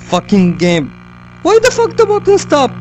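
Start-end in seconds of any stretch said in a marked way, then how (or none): 1.4-2.7 shrill frustrated yell; angry high outburst. Real, not acted. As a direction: rage at the game. Angry after game death.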